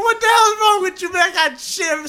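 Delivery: high-pitched panicked dad yell; retold exasperated shout. Dad retelling how he yelled at his son mid-diaper, exasperated shout. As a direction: re-enact yelling in a frantic, high voice.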